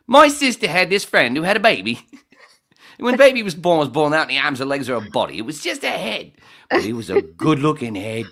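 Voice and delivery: loud, high-pitched comic impression; put-on shouting stand-up voice; Rodney Rude. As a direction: belt the joke out at full volume. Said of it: "so I was doing Rodney Rude".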